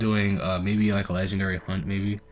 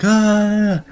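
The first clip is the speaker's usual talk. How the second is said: high, loud imitated crowd roar; crowd cheering; 13 semitones higher, 11 dB louder than usual. The crowd cheering him on, a loud high roar. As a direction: let out a big cheering yell for the crowd. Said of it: "everybody started cheering, like".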